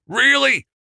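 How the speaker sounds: loud, high-pitched incredulous growl. A narrator's reading. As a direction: growl it as a sharp, high challenge. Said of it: "Frank growled".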